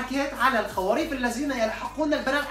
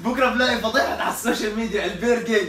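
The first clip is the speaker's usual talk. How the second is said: loud laughing protest; laughing denial; 6 dB louder than usual. Real, not acted. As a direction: deny it loudly while laughing.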